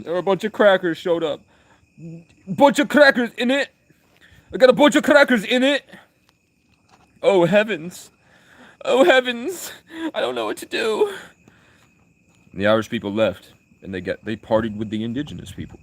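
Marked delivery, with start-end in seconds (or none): 0.0-11.1 very high, loud caricature of the English; mock English caricature. English writing home about the crackers, high-pitched mock alarm. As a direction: squeal it like a scandalised Englishman. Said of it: "And the English were like".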